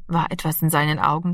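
plain reading voice

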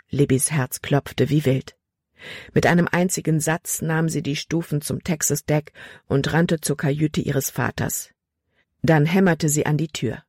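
ordinary delivery

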